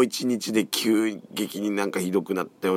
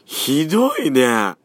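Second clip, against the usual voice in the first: hoarse, croaky voice; 8 semitones higher, 7 dB louder, slower than usual. Real, not acted.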